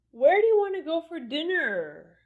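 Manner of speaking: high, loud question sliding down on "dinner"; exaggerated falling intonation. Shown as a demonstration. Teacher exaggerating for learners to copy, falling intonation on "dinner". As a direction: draw out "dinner" as it falls. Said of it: "in a very exaggerated way".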